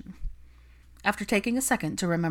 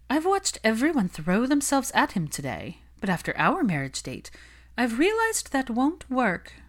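higher, knowing gossip voice; plotting socialite; 7 semitones higher than usual. Acted. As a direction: share the secret strategy. Olivia plots.

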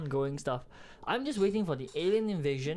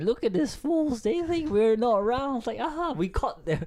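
high-pitched mocking alien voice; gloating aliens; 8 semitones higher than usual. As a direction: play aliens gleefully laughing at human fools. Acts out aliens mocking humans.

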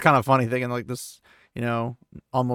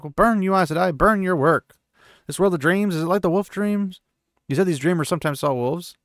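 raised, furious voice, higher; angry shout; 6 semitones higher, 3 dB louder than usual. Acted. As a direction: snap it at her in anger. Perrin yells at the Aes Sedai.

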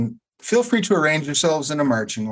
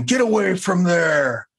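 giant shout; 6 semitones higher than usual. Acted.

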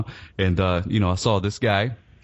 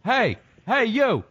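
much higher, eager calling out to a stranger; excited calling out; 11 semitones higher than usual. Retold or imitated. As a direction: hail him across the room, thrilled. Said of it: "and I'm like".

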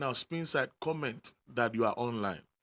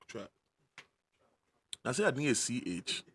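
ordinary delivery

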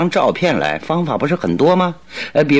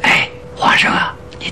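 hushed whisper into Watson's ear. Acted. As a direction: lean in and whisper conspiratorially. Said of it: "小声地说".

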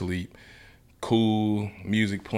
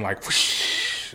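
whispered whoosh; 3 dB quieter than usual. Retold or imitated. Glass flying in crash.

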